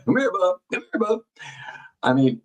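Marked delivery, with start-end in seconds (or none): 0.1-1.2 higher, lightly imitating a man calling his dog; a hint of a friendly dog call. Imitating the man on the tape calling his dog, lightly voiced. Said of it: "you hear him say".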